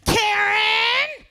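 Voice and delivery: high, loud, shouted Karen; mock shout. Lady yells at comic in line, mock shout. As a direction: yell the insult across the store. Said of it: "she goes".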